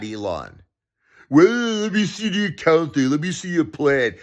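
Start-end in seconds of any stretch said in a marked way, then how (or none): 1.3-4.2 high-pitched mimicry of his demand; mocking sarcastic imitation. Mimicking the billionaire's demand for accounting, mocking. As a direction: throw his words back in a high mocking voice. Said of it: "with a sarcastic statement".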